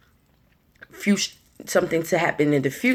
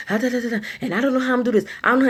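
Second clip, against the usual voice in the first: high, flustered mock panic babble; frazzled stressed person; 7 semitones higher, much faster than usual. Imitating a stressed person who can't hear the answer, high and flustered. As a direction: play someone too busy panicking to listen. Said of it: "they so busy".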